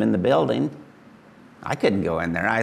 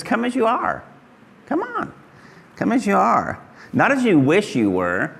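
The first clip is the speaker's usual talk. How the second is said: gentle, inviting voice of Jesus, pitched up; 9 semitones higher than usual. Acted. Preacher voicing Jesus inviting sinners to come as they are. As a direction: call them in kindly as Jesus would.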